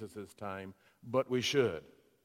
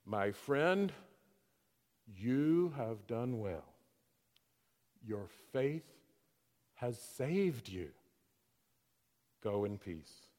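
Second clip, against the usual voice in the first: gentle blessing; 4 semitones lower, 5 dB quieter, slower than usual. Retold or imitated. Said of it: "and said".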